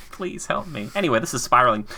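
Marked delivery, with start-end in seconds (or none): 0.1-0.9 small, softer plea for help; softer mock plea. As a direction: beg meekly, half joking.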